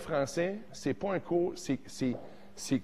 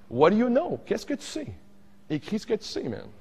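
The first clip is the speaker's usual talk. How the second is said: higher, teasing "What do you know?"; mock challenge; 7 semitones higher than usual. Teacher playfully challenges teen students. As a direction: needle them playfully.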